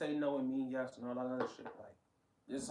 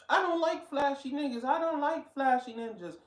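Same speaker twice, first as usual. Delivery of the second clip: higher, louder mock protest; mock-indignant quote, pitched up; mocking imitation; 9 semitones higher, 5 dB louder than usual. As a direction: parrot her claim in an exaggerated high voice. Mocking the woman's claim.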